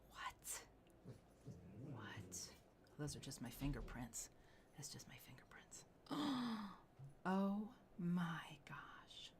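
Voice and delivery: breathless whispered awe; awed whisper. Real, not acted. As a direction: whisper it, stunned by the stone. Host awed by red saddle.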